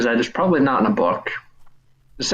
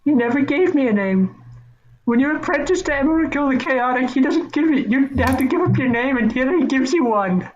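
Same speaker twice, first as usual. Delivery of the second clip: much higher timid gnome voice; squeaky gnome voice; 11 semitones higher than usual. Acted. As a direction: speak up high like a tiny apprentice. Gnome answers, high voice.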